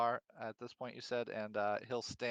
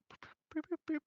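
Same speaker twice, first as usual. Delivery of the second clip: whistle noise; faster than usual. Acted. Player imitates whistle.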